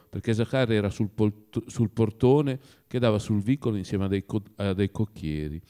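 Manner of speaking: ordinary delivery, nothing marked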